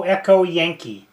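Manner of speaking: ordinary talk, nothing marked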